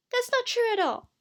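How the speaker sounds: very high, loud protest; outraged denial. Acted. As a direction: cry out in indignant denial. Crystal protests the insult.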